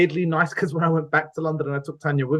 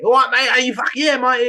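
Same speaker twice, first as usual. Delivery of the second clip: mock cockney, higher and louder; 8 semitones higher, 4 dB louder than usual. Mocking how films show Brits, hammed-up cockney. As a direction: ham up a cheeky cockney geezer accent. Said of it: "really fucking cockney".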